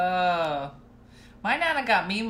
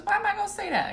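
grandma objecting; 13 semitones higher, 3 dB louder, faster than usual. Retold or imitated. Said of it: "She goes".

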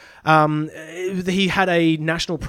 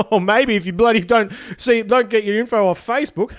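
mock joke; 6 semitones higher, 4 dB louder than usual. Morrison's joke to press.

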